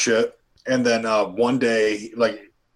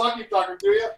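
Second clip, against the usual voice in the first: teasing falsetto. Real, not acted. Joking while walking off.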